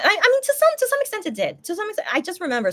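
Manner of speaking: trying to hold it together and failing; voice cracking. Real, not acted. Remembering members leaving, voice cracking into tears. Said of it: "sorry, sorry. I'm just, like, trying to contain".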